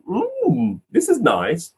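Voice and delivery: high, pretend savouring hum; hammed-up, high-pitched appreciation; mock enjoyment. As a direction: play along with a child's tea party. Playing along with child's pretend tea.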